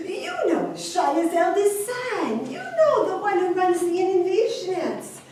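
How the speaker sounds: high-pitched, insistent explaining; insistent prompting. Acted. Host insists on whom he means.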